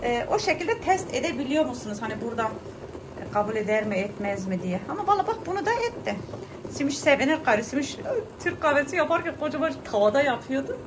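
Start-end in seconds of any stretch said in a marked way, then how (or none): 4.9-6.2 laughing. Real, not acted. Laughing at pan test.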